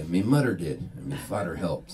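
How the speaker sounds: put-on light Newfoundland accent, "mutter" and "fodder" for mother and father; a hint of a Newfoundland local's answer. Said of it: "the weirdest accent in Newfoundland".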